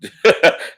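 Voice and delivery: high burst of real laughter; big loud laugh. Real, not acted. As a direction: crack up loudly at the joke. Laughing at the daddy joke.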